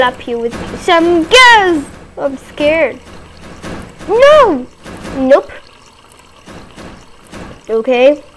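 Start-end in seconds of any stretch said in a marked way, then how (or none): 4.1-4.7 high shouted no; sudden cry. Real, not acted. Gamer startled by the game, sudden cry. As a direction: yelp in surprise.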